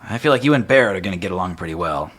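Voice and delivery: bright, quick friendly guard voice; a cheerful guard welcoming newcomers; lightly voiced town guard. Cheerful guard greets the new party, brisk and friendly.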